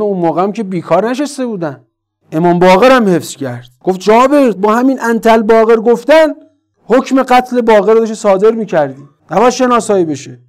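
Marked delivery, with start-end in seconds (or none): 4.0-10.4 higher, insistent retold reproach; retold angry scolding. Imam warning Jaber his son must stay unidentified, retold angry scolding. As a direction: press the complaint in a raised pitch, stopping short of yelling. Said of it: "سر جابر داد زد، شکایت کرد، شاکی بود".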